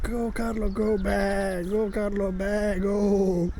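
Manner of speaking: playful sheep chant; sheep cheering. Pretend. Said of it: "they're all saying".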